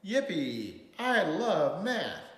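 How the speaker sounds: gleeful, drawn-out storybook holler; loud exclamation. A narrator's reading. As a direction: whoop it out, stretching the words. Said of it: "his words came out so loud".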